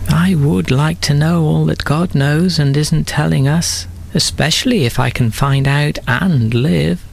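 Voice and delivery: lightly voiced tempted Eve. A narrator's reading. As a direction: let desire creep in. Eve's tempted thought.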